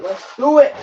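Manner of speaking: hyped-up rising shout; excited. Shown as a demonstration. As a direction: pump it up on the repeat.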